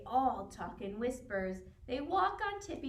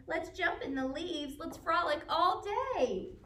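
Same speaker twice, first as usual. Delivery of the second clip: lightly higher, happy bear cheering; excited bear; 6 semitones higher than usual. A narrator's reading. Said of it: "Bear cries".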